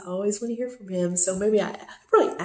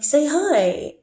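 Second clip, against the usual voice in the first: higher, louder, pushy "say hi"; mock eager prompt; 7 semitones higher, 7 dB louder than usual. Boyfriend pushing the phone at his girlfriend, eagerly. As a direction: nudge her to greet the camera. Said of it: "and he's like".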